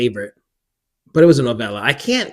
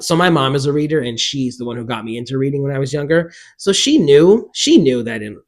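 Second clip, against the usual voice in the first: ordinary delivery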